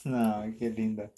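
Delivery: tender cooing. Real, not acted. Charmed adult cooing at little Sofía, slow and soft.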